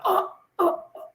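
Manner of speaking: high, squeezed gasps; imitated breathlessness. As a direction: gasp as if pinned and unable to breathe. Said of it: "I couldn't breathe".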